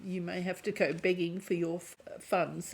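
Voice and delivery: normal talking voice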